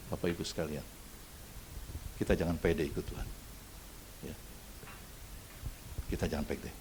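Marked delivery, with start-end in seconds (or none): none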